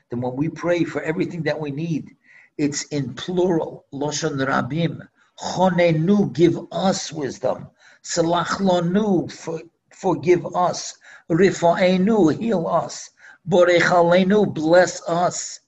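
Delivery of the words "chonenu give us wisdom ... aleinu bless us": loud, slow, hammering each prayer word; preacher building the list of pleas; emphatic recitation. Real, not acted. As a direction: proclaim each plea to the congregation.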